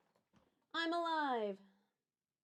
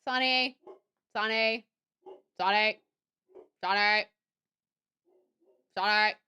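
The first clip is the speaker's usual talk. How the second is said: calling the dog; 5 semitones lower, 5 dB louder than usual. Real, not acted.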